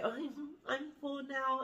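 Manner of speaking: lightly mocking a picky eater on a date; hammed-up dainty lady who's already full. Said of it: "no salad eating, old tiny bite".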